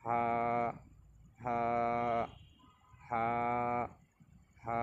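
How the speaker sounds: হা held long on a level note; recitation demo. Shown as a demonstration. Teacher recites the letter forms, drawn-out ha. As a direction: draw out each হা evenly from the mouth. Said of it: "পরে উচ্চারণ করে আরেকবার".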